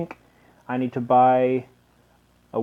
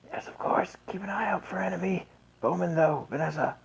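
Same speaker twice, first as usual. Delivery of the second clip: hushed, breathy royal command; whispered orders; 6 semitones higher, 7 dB quieter than usual. Acted. Voicing the princess's orders.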